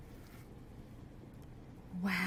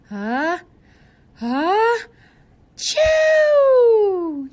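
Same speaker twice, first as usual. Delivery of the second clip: building sneeze, higher and louder to a shouted chew; huge hammed-up ah-ah-chew; big bear sneeze; 12 semitones higher, 15 dB louder, much slower than usual. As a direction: wind up the sneeze and blast it.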